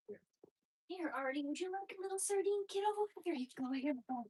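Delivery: high-pitched sweet talk to a pet; pet-directed coaxing. Real, not acted. Offering Artie a little sardine, high coaxing. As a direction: coax a pet in a lifted, lilting voice.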